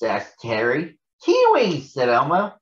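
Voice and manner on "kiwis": very high, louder burst. Acted. Elmo answering Harry's question about the green fruit. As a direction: announce it with glee.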